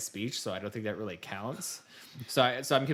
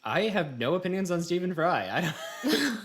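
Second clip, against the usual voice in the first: words laughed out, higher and louder; laughing; 8 semitones higher, 5 dB louder than usual. Real, not acted. Laughing to co-host about having no Stephen Fry opinion. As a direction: giggle through the line as it breaks up.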